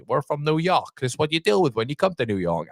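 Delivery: lightly put-on New York swagger; mock New York accent. Quoting New York fans at Ryder.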